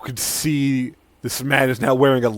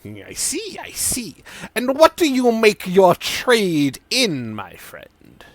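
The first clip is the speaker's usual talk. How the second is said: musing, then higher eager questions; Sidon NPC voice; 6 semitones higher, 5 dB louder than usual. Acted. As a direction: quiz the customer like a curious quack.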